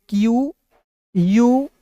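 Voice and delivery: very slow, each letter drawn out; teacher spelling a word for the class. Shown as a demonstration. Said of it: "मैं लिखना चाहता हूँ".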